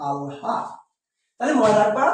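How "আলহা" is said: আলহা low and soft, split "al-ha"; corrected pronunciation. Shown as a demonstration. Teacher repeating the correct form, আলহা as al-ha. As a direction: sound out আলহা as al, then ha. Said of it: "এটার উচ্চারণ হবে".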